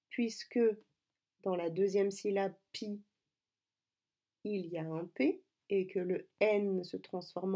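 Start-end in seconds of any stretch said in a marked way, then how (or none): none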